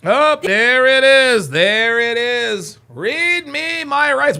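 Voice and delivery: slow, high, loud mocking gloat; mocking singsong. Real, not acted. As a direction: drag it out like a taunt. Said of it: "We haven't had that one in a while".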